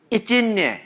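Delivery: high, matter-of-fact mama voice; a hint of an Italian mother, unbothered; Prego mama. Mama answering her son over the sauce pot, high mock voice. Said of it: "The mama looks at him and goes".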